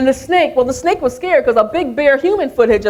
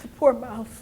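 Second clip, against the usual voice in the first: usual speaking voice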